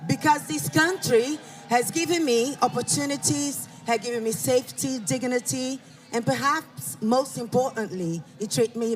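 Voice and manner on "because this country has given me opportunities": ordinary talk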